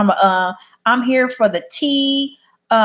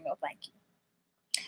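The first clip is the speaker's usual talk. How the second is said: drawn-out, much softer no thank you; slow hushed refusal; 7 semitones lower, 19 dB quieter, much slower than usual. Real, not acted. Refusing to go back to her channel, slow hushed no. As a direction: breathe the refusal out slowly, dismissively.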